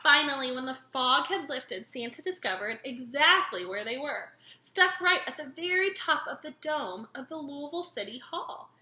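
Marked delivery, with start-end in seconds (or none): none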